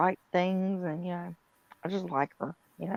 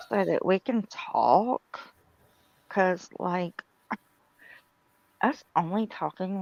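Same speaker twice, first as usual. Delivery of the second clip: put-on Valley Girl; 3 dB louder than usual. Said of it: "She's doing a Valley Girl".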